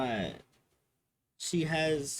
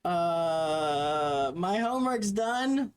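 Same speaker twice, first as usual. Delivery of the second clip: put-on proud kid; 4 semitones higher, 5 dB louder, much slower than usual.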